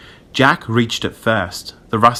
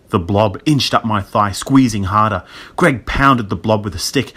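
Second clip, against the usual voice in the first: plain reading voice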